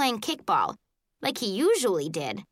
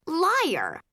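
indignant shout; 7 semitones higher than usual. A narrator's reading. Accusing shout in narration.

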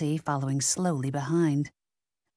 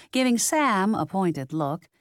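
ordinary delivery

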